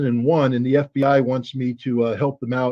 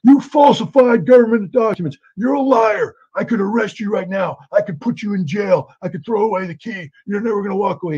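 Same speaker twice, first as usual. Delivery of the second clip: shouting interrogator, high and loud; retold screaming; 9 semitones higher, 3 dB louder than usual. FBI agent screaming at Marina in polygraph, angry shout. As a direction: yell the accusations like a hostile agent. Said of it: "starts screaming at her".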